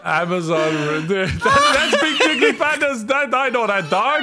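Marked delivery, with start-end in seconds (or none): 1.3-4.2 high, laughing squeal. Real, not acted. Laughing at dog photo.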